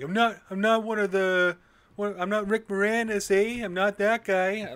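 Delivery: high, loud cartoon Canadian accent. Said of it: "that stereotypical Canadian".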